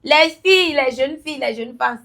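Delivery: loud high hail, easing off; calling out. Real, not acted. Guest addressing young women, loud call that settles. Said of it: "Elle va crier encore".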